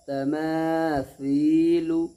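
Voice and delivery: slow तमासीलु, सी softened to "thee"; correct sa sound. Shown as a demonstration. Teacher repeating the right reading, drawn-out th sound. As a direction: say तमासीलु with th, stretching मा and सी. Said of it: "सा भी निकालना है".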